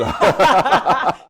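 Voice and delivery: big laugh, high and loud. Real, not acted. Guest laughing after joking about his father's singing. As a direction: burst out laughing at the joke.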